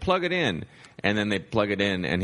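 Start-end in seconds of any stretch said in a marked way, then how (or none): 0.0-0.6 high quick order; breezy command. Retold or imitated. Comic telling the bassist to plug in, high and breezy. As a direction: toss it off in a raised voice. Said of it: "He's like".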